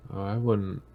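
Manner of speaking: normal talking voice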